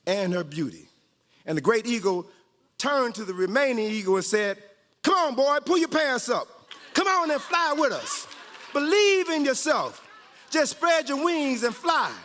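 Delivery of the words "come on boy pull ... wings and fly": rousing urging. Acted. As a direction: cheer him on, almost shouting. Urging the last eagle.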